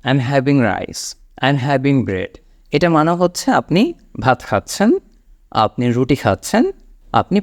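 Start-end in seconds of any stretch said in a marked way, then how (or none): 0.0-2.4 slow model sentence. Shown as a demonstration. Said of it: "advance ইংলিশে আপনি এইটা বলতে পারেন".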